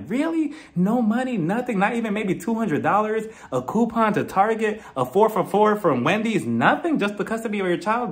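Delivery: high-pitched mock disbelief, item after item; incredulous questioning. Vlogger reacting to a no-money divorce, incredulous questioning. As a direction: question it like you cannot believe it.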